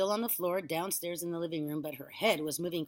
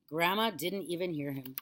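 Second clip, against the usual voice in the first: ordinary delivery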